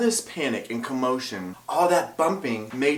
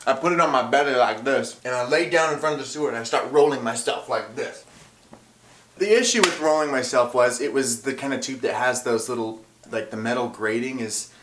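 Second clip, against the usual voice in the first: ordinary delivery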